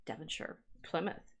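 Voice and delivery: Devonshire whispered and savoured, lightly mock posh; hushed, savouring a posh-sounding place; mock posh murmur. Shown as a demonstration. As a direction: breathe the names like something fancy. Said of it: "That sounds posh".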